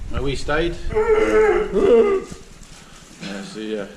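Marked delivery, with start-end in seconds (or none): 0.9-2.3 high, loud showing off; excited reveal. Real, not acted. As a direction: present the room with a flourish. Showing the big room.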